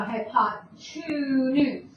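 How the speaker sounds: "hypotenuse" broken up, TWO drawn out as a hint; two stressed inside word. Shown as a demonstration. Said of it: "I'm saying the number two".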